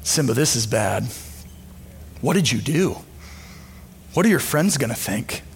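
a villain uncle murmuring poison; sinister whisper. Retold or imitated. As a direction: hiss doubt into his ear like a tempter. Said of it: "whispers into your ear".

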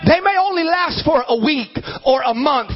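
high, rousing sermon delivery; fervent preaching. Real, not acted. Preacher contrasting brief storms with eternity, rousing preaching. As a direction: rouse the congregation with it.

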